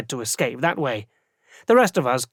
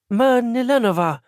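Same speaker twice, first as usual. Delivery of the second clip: put-on landlady calls out in a Barajese accent, pitched higher; 8 semitones higher than usual. A narrator's reading. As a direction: Barajese landlady addressing the arrested boarder. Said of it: "she said in a strong Barajese accent".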